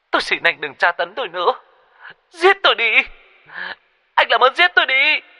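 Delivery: high-pitched woman sobbing in desperation, rising to loud cries; sobbing plea. A narrator's reading. Woman on the phone begging for death, sobbing. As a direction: beg through sobs, voice shooting way up. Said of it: "khóc nức nở, giọng yếu ớt".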